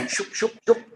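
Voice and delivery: high, punchy "shoop" noises; hip-swivel sound effects. Retold or imitated. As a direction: voice each hip thrust as a sharp sound. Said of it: "And he goes".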